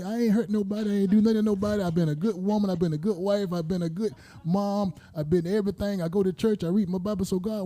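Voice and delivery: high, anguished plea to God; a hint of a wronged woman crying out to God; pleading with God. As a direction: ask heaven why, half-acted lament. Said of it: "did the question ever come to you, say".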